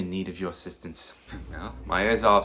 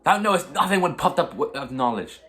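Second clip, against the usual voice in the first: much higher and louder, raging denial; furious shout; 8 semitones higher, 5 dB louder than usual. Acted. Hamlet to the doctor who doubts him, furious shouting. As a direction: hurl it at him like an insulted prince.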